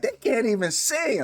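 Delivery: high-pitched protesting quote; mock objection. Voices listeners' imagined objection.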